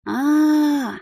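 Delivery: high, hesitant call to the mage. Acted. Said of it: "позвала я чернокнижника".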